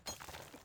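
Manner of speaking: quick whispered laugh; a short breathy chuckle at the caller; soft laugh. Real, not acted. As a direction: silent giggle under the breath. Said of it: "I started laughing".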